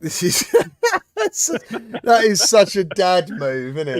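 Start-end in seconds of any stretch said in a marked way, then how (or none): 0.5-2.0 a big high laugh bursting out; loud burst of laughter. Real, not acted. Host bursting into loud laughter at his co-host's joke. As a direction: crack up loudly at the joke.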